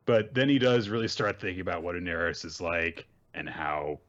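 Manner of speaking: ordinary delivery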